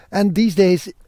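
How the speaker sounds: ordinary delivery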